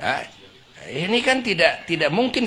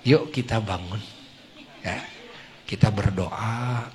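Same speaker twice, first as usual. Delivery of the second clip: quiet lower-pitched loving call; gentle waking; 8 semitones lower, 6 dB quieter than usual. Shown as a demonstration. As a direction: coax him awake tenderly. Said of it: "Begini caranya".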